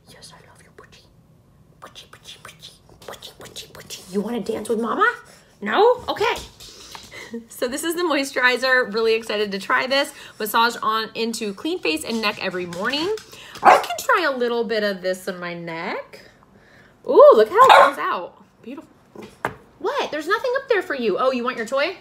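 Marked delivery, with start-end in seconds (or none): 0.1-4.1 breathy whispered pet names; soft whispered cooing; whispering to dog. Real, not acted. 19.8-21.2 higher, quick pet talk; high fast pet voice; talk to dog. Real, not acted. Talking to dog.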